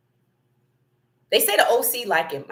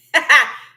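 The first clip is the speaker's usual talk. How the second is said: high, loud burst of laughter; real amused cackle; laughing; 6 semitones higher, 9 dB louder than usual. Real, not acted. Host laughing at own chat.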